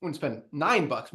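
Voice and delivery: ordinary delivery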